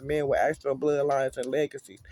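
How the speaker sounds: ordinary talk, nothing marked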